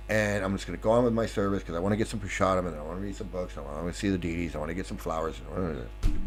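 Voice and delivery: mimicked devotee running on low and soft; dismissive trailing mumble. Devotee pretending it's not there, low fading mumble. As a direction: fade away through a list of excuses.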